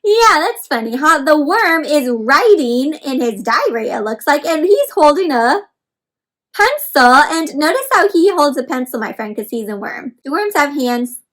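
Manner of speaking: ordinary talk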